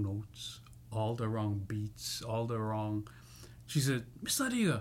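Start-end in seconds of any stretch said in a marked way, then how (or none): 4.2-4.8 high-pitched mock appeal; imitated complaint. Singer complains to the promoter, imitated high-pitched appeal. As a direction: call out the name in higher-pitched protest.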